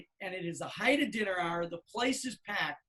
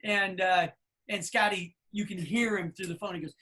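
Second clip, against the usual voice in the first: ordinary delivery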